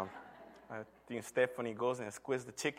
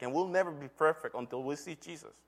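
ordinary talk, nothing marked

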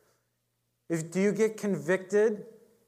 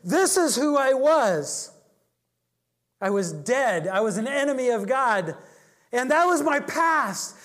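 forceful preaching; 8 semitones higher, 6 dB louder than usual. Retold or imitated. Ironside preaching to the woman.

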